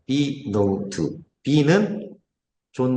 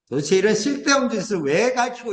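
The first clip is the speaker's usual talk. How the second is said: pitched-up, incredulous grumble; exasperated complaint; 6 semitones higher than usual. Retold or imitated. Father helping his high-school son with Korean homework, exasperated. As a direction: complain about pointless teaching, voice climbing.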